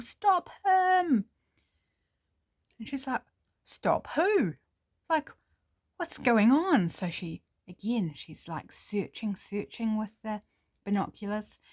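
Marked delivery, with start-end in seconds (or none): 0.0-1.2 high, loud shout; yelling. Acted. Axe man yelling at the passing thief, high loud shout. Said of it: "running down the street yelling". 3.6-6.9 higher, bewildered question; puzzled, as if caught off guard; confused surprise. Acted. Birdwatcher bewildered by the yelling, higher confused question.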